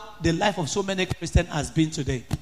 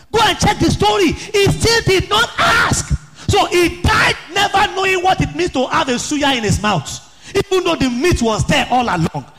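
loud, much higher shouted preaching; fiery preaching; 7 semitones higher, 3 dB louder than usual. Acted. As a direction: drive the point home at full force. Preaching at full force.

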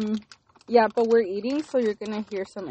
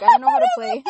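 put-on squeaky high childish voice; high mock voice; 9 dB louder, faster than usual. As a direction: act clueless in a tiny voice. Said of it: "You're like".

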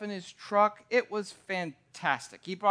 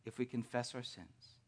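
softer, intimate sermon voice; soft reflective preaching; 7 semitones lower, 7 dB quieter than usual. Acted. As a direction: draw the congregation in quietly. Quiet reflective sermon passage.

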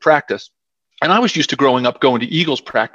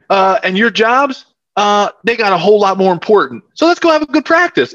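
higher-pitched imitation of a Southern coach's accent; 7 semitones higher than usual. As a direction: lay down the law in a North Carolina accent. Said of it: "tried to do my Southern accent".

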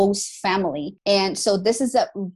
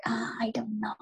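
unsure whisper; whispered hesitation to friends; whispered doubt; 10 dB quieter than usual. Retold or imitated.

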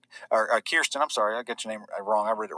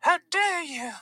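very high mock-indignant girl's voice; Greta impression; slower than usual. Activist scolding the adults, high-pitched mock impression. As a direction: scold in a squeaky put-on voice.

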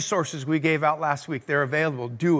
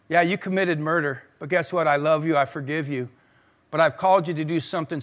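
tender voice of God; 4 semitones lower than usual. Acted. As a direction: speak gently, forgiving him. Voicing God to Moses.

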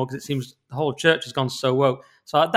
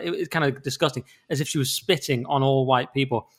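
ordinary talk

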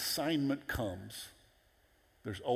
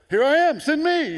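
high, loud excited shout; eager volunteering; 11 dB louder than usual. Acted. Husband jumping at wife's sugar errand, eager high shout. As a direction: leap at the errand, voice shooting way up. Acting out his own eagerness.

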